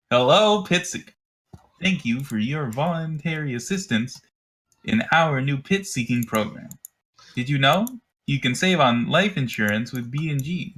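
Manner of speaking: high, bright recorded PA voice; canned announcement voice. Acted. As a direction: read it like a cheerful recorded announcement. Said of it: "a canned voice that says".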